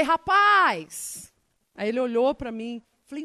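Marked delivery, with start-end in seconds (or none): 0.0-1.3 loud, high hail across the street; calling out. Retold or imitated. Preacher hailing the passerby to stop him, loud. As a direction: stop him with a bright call.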